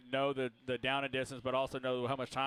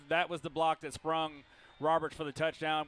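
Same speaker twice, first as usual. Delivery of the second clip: usual speaking voice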